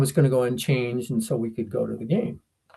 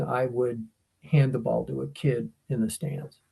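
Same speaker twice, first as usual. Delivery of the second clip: usual speaking voice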